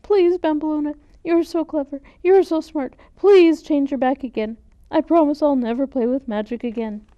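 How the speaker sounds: high, loud, desperate begging; tearful pleading. Acted. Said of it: "wept Antonia".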